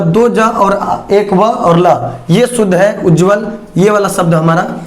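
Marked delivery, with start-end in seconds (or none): 0.0-2.1 letters named slowly one by one; slow spelling out. Shown as a demonstration. Teacher spelling the word for students, slow and deliberate. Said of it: "ध्यान से जब उच-, उच्चारण करेंगे".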